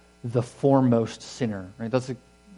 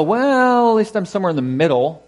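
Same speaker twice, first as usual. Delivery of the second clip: self-justifying; 7 semitones higher, 6 dB louder than usual. Pretend.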